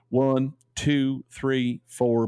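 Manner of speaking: a light put-on accent of the letter writer, counting slowly; reading the friend's letter aloud in a gentle borrowed accent; Gary's accent. Shown as a demonstration. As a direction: count the numbers in his lilting accent, lightly done. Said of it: "I like reading this in his accent".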